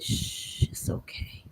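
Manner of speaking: whispered comfort, very soft; soft, consoling whisper; hushed soothing. Retold or imitated. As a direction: hush someone gently as they cry. Aunt comforting the crying speaker.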